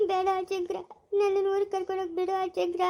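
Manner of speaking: high sobbing plea; crying. Acted. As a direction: beg through sobs to be taken home. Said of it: "ಅಜ್ಜಿ ಹೊಡೆದ್ರು ಅಂತ ಬೇಜಾರಾ". (ಬಿಕ್ಕಳಿಸುತ್ತಾ ಬೇಡುವುದು)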